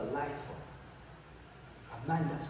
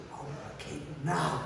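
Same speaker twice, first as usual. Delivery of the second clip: slow, weighty mock demand; insistent demand. Towering cellmate insists on cake, slow demand. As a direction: draw it out like an immovable bully.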